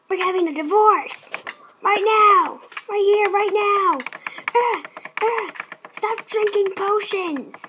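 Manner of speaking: very loud, emphatic declaration; mock angry shouting. Husband demanding divorce right now, mock angry shout. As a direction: lay down the law at full volume.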